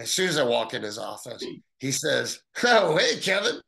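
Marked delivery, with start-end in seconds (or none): 2.6-3.6 high, loud retold hello; surprised greeting. Guy greeting Kevin as he walks in, surprised. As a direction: hail an unexpected visitor, retold. Voices acquaintance's greeting on arrival.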